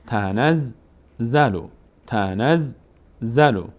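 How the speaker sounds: تنزلو drawn out very slowly, z held double; slow doubled z. Shown as a demonstration. Teacher slowly models تنزلو for learners. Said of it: "اینجا دو بار باید خوانده بشه".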